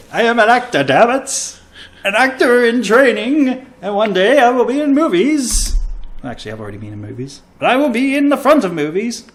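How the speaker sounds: loud, high, hammed-up declaration; mock grand actor. Defends his bad accents with a hammed-up actor's proclamation. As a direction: proclaim like a wounded aspiring star. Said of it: "I'm not even trying".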